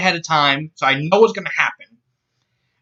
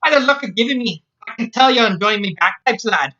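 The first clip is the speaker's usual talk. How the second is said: higher-pitched Scots woman's voice; Scots accent; 8 semitones higher than usual. Acted. Scotswoman greeting Jack about her bagpipes, Scots accent. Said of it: "she said in a Scots accent".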